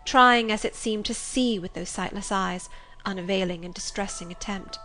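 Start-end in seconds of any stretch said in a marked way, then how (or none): none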